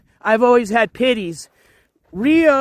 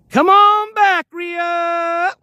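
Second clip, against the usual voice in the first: calling the dog; 7 semitones higher, much slower than usual. Real, not acted. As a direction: shout him back from the water, much higher. Calling dog back from water.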